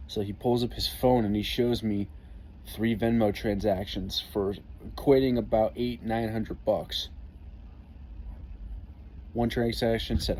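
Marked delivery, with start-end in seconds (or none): none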